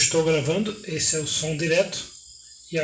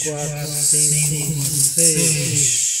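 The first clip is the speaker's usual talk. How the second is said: tape echo; much slower than usual. Shown as a demonstration. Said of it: "Agora eu vou aumentar o ganho".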